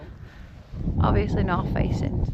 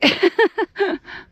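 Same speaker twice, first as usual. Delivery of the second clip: high laugh; 10 semitones higher, 3 dB louder than usual. Real, not acted. Laughing at off-piste retreat.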